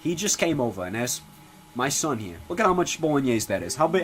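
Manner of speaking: ordinary talk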